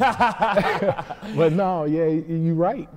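a flattered laugh, words dragged out as it fades; real laughter. Real, not acted. Fighter answering the interviewer's praise with real laughter.

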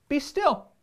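commanding Max. Acted. Max taming the wild things, firm high command. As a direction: order the monsters to freeze. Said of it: "Till Max said".

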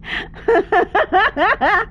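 high, rapid giggling fit; laugh. Real, not acted. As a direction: break into high-pitched laughter. Laughing while streaming the game.

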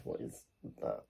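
sudden hushed whisper at a strange noise; whispering, startled by a noise behind her. Real, not acted. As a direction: breathe it out, alarmed by a sound.

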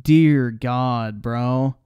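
ordinary talk, nothing marked

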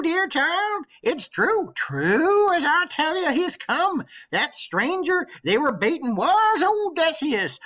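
put-on an old servant bursting with good news; old nurse, excited. Old nurse telling her queen the suitors are dead, eager and high.

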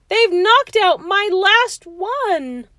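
high, slow, loud wail; shrieking. A narrator's reading. George loses his very last tooth, shrieking. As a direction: shriek it out in hammed-up horror. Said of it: "George shrieked".

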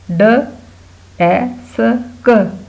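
ड, ए, स, क each said alone; sounding out spelling. Shown as a demonstration. Teacher drilling spelling with sounds, sounding out. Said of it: "spelling sounds के साथ याद करें".

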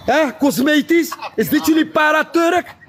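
high-pitched jeering to the crowd; mocking call, pitched well up; taunting. Real, not acted. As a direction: crow it high, drawing out the words. Crowing to audience over rival who left.

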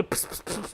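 soft unvoiced pıs pıs hiss; creeper hiss. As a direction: hiss like a creeper about to blow. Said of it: "ben de bir creeper'ım". (creeper gibi tıslama)

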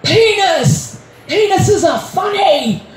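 high, drawn-out hammed-up enthusiasm; slow, silly put-on exclaiming; mocking partner. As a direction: crow it like an overgrown schoolkid. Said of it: "my partner said".

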